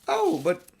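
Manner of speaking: high, loud and broken off; startled protest. A narrator's reading. Susan cut short, surprised and protesting. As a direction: start to object, then stop. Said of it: "began Susan, and then stopped".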